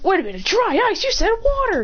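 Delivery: high-pitched, incredulous protest; startled objection. Acted. Retold startled objection to dry ice.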